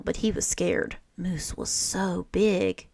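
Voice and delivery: ordinary delivery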